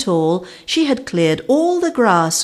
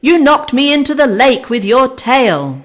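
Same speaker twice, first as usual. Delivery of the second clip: loud, high accusing shout; angry yell; 7 semitones higher, 7 dB louder than usual. A narrator's reading. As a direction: blame her, shouting. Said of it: "yelled Vic".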